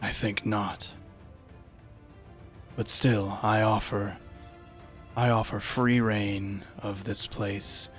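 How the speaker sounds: low, slow echoing voice; a haunting voice from the dark; unearthly voice. Acted. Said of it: "an echoing voice from down the hall".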